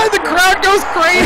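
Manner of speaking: high, loud, laughing outburst. Real, not acted. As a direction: crack up while blurting it out. Laughing at a played clip.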